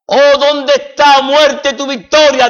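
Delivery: ringing sermon cry; triumphant preaching. Acted. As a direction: declare victory over death from the pulpit. Preacher proclaims Christ's triumph.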